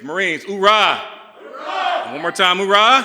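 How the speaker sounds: rousing, high, loud battle cry; hoorah shout. Real, not acted. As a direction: pump up the troops with a yell. Rallying Marines crowd, call-and-response.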